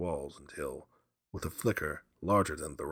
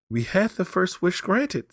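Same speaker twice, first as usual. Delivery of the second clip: high, loud, slow fevered urging; burn with urgency; old woman urging her husband; feverish; 10 dB louder than usual. A narrator's reading. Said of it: "said the old woman feverishly".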